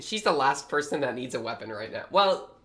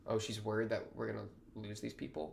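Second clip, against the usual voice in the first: ordinary talk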